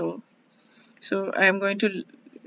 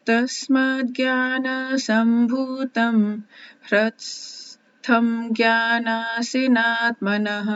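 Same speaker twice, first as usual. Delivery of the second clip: Sanskrit verse recitation; 2 semitones higher, faster than usual. Acted. Reciting the Gita verse to her listeners, chanted. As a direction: intone each line like scripture.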